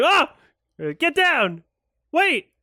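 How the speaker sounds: high, loud hammed-up panic; mock alarmed warning. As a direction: play up the urgent danger call. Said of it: "an appropriate thing would have been to say".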